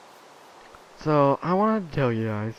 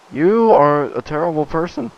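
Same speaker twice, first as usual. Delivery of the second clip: mock scold; 6 semitones higher, 11 dB louder than usual. Scolding herself in mock outrage.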